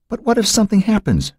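very high, frightened plea; begged. Acted. Joan begging her sister in labor, frightened and pleading. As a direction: beg her to let you call for help. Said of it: "Joan begged".

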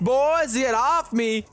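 high, crowing victory call; excited gloating. Real, not acted. Gamer shaking off opponents, excited gloating. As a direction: crow it high at the beaten players.

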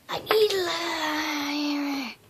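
hammed-up, very slow begging; mock pleading wail. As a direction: stretch it into a needy wail. Mock begging viewers for love.

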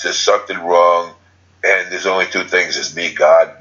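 ordinary talk

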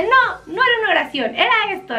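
imitar un regaño en tono claramente más agudo; regaño imitado. Pretend. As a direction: corregir como quien regaña, con la voz más alta de tono. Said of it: "igual y me regaña al rato". (regaño fingido, agudo)

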